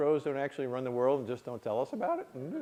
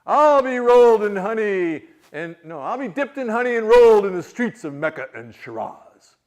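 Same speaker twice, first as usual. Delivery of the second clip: loud, higher, slow curse; grandpa's oath; 6 semitones higher, 15 dB louder than usual. Retold or imitated. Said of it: "reminded me another one".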